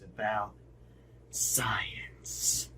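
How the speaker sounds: breathy whisper on the word. Acted. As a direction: drop to a whisper. Whispered end of intro.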